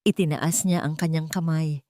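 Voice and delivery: ordinary delivery, nothing marked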